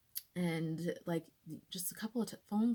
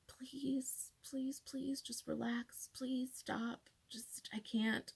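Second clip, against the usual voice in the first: breathy pleading whisper, a little higher; desperate whisper; 5 semitones higher, 6 dB quieter, much slower than usual. Retold or imitated. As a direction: beg under your breath so the judge won't hear. Begging her arm to stop.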